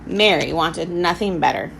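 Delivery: plain reading voice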